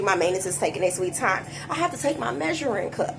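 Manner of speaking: ordinary delivery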